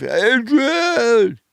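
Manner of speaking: drawn-out, hammed-up Rocky call; mock Rocky Adrian call. Quoting Rocky to the audience, a hammed-up slow call.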